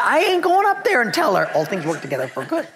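comic refusal. Retold or imitated. Freshman refusing to visit the dying woman, comic protest. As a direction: protest it high for laughs, hammed-up.

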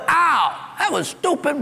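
high, hammed-up yelp of pain; mock outcry when someone steps on his foot, high; mock shout. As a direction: ham up being stepped on.

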